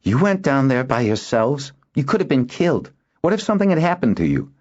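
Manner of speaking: high-pitched, indignant scolding; furious. A narrator's reading. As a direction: scold them in angry alarm. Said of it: "Zena was furious with them".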